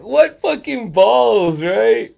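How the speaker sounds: slow, high, loud words through laughter; cracking up in admiration; laughing disbelief. Real, not acted. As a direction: laugh while marveling at the nerve. Laughing at Peacemaker's line.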